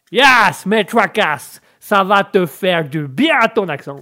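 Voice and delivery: put-on drawling American-accented French; hearty American coach, stretched-out words. Coach saying it will help the accent, hearty American accent. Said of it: "des cours avec l'anglais et l'américain".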